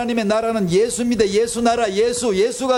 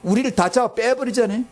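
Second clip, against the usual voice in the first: ordinary talk, nothing marked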